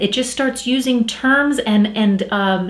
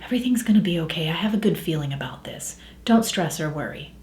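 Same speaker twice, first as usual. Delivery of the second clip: soft, low reassuring whisper; hushed, reassuring inner voice; 5 semitones lower, 9 dB quieter, faster than usual. Acted. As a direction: whisper it like a quiet inner thought. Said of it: "your brain whispers and says".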